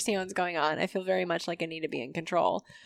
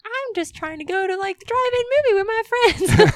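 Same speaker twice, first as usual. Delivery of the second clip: put-on much higher, fast, whiny kid voice; whiny teen; 13 semitones higher, 8 dB louder than usual. Answering society's age rules, a whiny high teen protest. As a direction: play the teenager who just wants to go out. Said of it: "And you're like".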